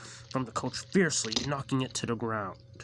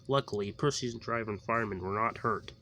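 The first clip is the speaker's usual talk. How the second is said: usual speaking voice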